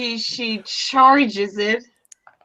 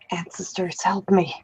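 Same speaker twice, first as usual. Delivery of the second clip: low, very soft murmur to the ancestors; hushed, lowered in-character prayer; whispered plea; 4 semitones lower, 8 dB quieter, faster than usual. Acted. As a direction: softly beg for help under your breath.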